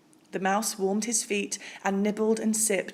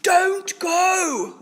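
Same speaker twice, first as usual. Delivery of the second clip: loud, much higher wail; 10 semitones higher, 8 dB louder than usual. A narrator's reading. Bear wails at the departing mouse, loud. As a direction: wail after him. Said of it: "wailed Bear".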